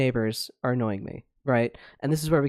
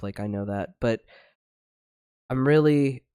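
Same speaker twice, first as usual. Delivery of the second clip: ordinary talk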